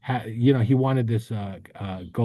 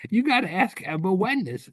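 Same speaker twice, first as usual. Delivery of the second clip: wife correcting; 11 semitones higher than usual. Retold or imitated. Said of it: "And she said".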